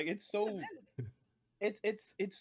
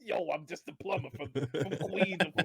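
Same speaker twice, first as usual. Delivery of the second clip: mock New York plumber voice; hammed-up Queens accent; New Yorker accent; 2 semitones higher, 4 dB louder than usual. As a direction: play a working-class New Yorker. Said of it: "have a New Yorker accent".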